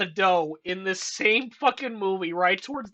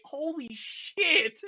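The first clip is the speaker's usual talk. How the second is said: 1 imagined awe; 6 semitones higher, much slower than usual. Retold or imitated. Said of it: "I would have been like".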